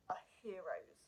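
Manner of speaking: choked-up real whisper; barely voiced, moved to tears; emotional whisper. Real, not acted. Honouring the passengers of the flight, whispered. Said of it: "I get quite emotional".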